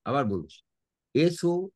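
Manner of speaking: usual speaking voice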